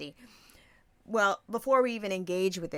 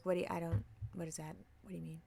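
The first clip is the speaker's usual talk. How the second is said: very soft, halting questions; feigned confusion; 2 semitones lower, 10 dB quieter, faster than usual. Retold or imitated. Host playing dumb when asked what they are, softly baffled. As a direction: play baffled under your breath.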